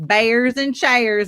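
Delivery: slow, drawn-out South Carolina twang; thick Southern accent. Retold or imitated. Imitating coworker back from lunch, thick Southern accent. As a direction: stretch the vowels in an imitated Southern drawl. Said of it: "get a real thick accent".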